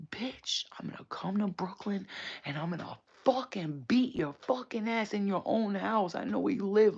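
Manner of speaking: high opening, emphatic mock threat; imitated angry threat. Imitating sister threatening Tanisha on Instagram, angry and menacing. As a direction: play the sister spoiling for a fight. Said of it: "threatened Tanisha's life on".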